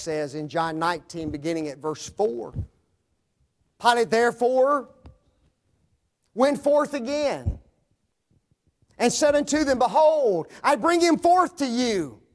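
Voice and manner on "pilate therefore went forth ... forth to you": raised, loud pulpit voice proclaiming scripture; full force preaching. Acted. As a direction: ring it out to the back pews. Preacher narrates Pilate at full force.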